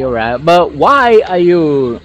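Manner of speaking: ordinary delivery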